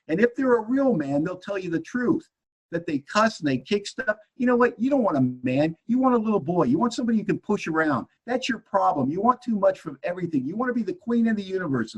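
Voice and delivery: high, agitated retold tirade; ranting husband. Acted quarrel with his wife.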